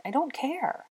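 high-pitched, through clenched teeth; desperate. A narrator's reading. She insists that he sing, high and desperate. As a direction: push it out tightly, desperate. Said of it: "through clenched teeth, getting desperate".

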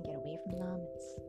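plain reading voice

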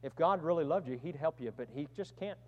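a soft, sly tempter fading to almost nothing; Satan's voice. Retold or imitated. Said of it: "Satan gets you to think".

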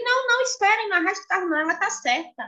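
high, hurried "não, não, espere"; man conceding. Retold or imitated.

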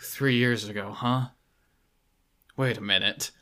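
lightly voiced, hushed realisation; quiet Cage musing. Cage answering Graves about the dead woman, low and hushed.

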